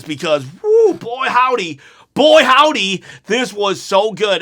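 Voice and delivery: ordinary talk, nothing marked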